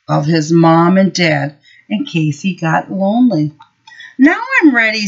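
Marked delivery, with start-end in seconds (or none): 4.2-5.1 put-on eager little boy. Eager child Henry voiced.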